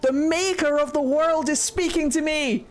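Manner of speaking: hammed-up holy calling; inspired Andraste. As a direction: proclaim like a chosen prophet. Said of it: "And she's like".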